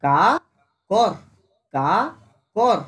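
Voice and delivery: কা and কর as separate, spaced syllables; slowed down. Shown as a demonstration. Teacher splitting a word for students, slowed down. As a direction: break it into কা, pause, কর. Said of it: "এটাকে slow কর".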